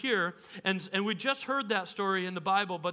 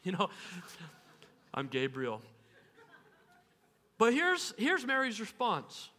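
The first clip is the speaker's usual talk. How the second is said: ordinary talk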